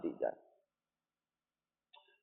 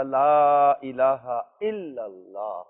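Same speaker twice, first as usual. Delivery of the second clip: inner prayer; 5 semitones lower, much slower than usual. Acted. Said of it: "دل میں ہی ... کہنے لگا".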